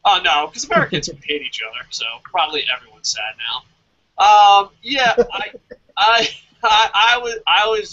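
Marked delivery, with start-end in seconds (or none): none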